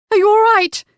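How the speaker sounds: high, loud, panicked woman's voice; frantic. Acted. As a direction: beg to know he's alive. Woman kneels by shot man.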